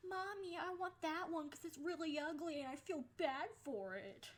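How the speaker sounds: put-on high little-kid whine to mommy; mock child voice. Mocking a classmate in a skit, as a whiny kid. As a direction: play a bratty kid pointing at a pet.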